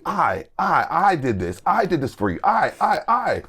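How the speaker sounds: mock his self-obsessed stammer; imitating a pastor stuck on I; mocking the pastor's I I I. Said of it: "And he keeps saying".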